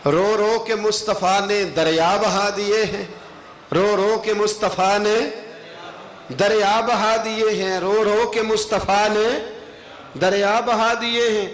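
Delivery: measured, sustained declamation; devotional verse recitation. Acted. Preacher repeats a line of praise verse to the congregation, sustained declamation. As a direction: declaim the line and let it ring.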